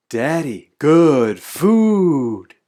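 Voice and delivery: loud, stretched-out cheer; big, drawn-out delighted announcement; excited child. A narrator's reading. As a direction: belt it out proudly, long on FOOD. Child proudly shows candy to father.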